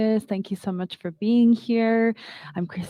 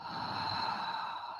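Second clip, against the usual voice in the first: long audible exhale out; slow deep breaths; 15 dB quieter than usual. Shown as a demonstration. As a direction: breathe out slowly with a sigh. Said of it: "and exhale out".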